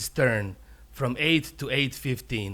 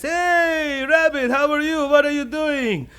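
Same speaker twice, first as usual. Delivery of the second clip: high, loud, sing-out hello; over-friendly high-pitched bear; jolly greeting; 12 semitones higher, 5 dB louder than usual. Acted. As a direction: greet a pal with hammed-up enthusiasm. Bear greets rabbit in acted voice.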